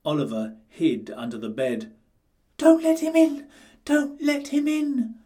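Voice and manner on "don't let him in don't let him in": high, squeaky piglet voice; frightened little pig, high and pleading; murmured. A narrator's reading. Frightened piglet Oliver hiding under the bed, squeaking high. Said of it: "murmured Oliver".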